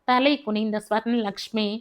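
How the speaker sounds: ordinary delivery, nothing marked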